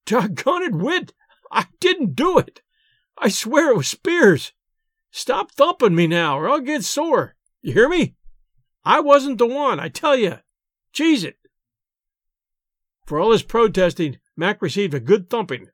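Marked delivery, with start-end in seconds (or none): 0.0-11.4 higher, exasperated pleading; half-frightened protest. A narrator's reading. McCall protests while being pummeled, high half-angry pleading. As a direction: protest your innocence, half afraid, half angry. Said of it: "He was half frightened, half angry".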